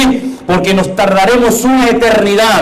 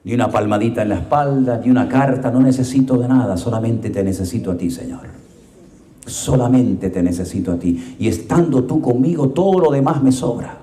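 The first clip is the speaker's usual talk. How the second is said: low, very soft prayer; hushed devotional prayer; intimate prayer; 11 semitones lower, 7 dB quieter than usual. Real, not acted. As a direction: whisper it to God alone. Quiet closing prayer.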